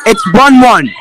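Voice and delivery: energetic sportscaster call of a straw-boat race; live play-by-play. Acted. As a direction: race commentator calling the action.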